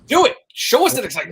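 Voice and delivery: loud, high-pitched urging; excited egging-on. Real, not acted. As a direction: cheer him on, loud and high. Eggs guest on to show excitement.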